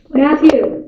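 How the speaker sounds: loud name-call; yelling down. Real, not acted. Kid calls someone in the basement, yelling. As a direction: shout a name to someone downstairs. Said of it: "Yell down there".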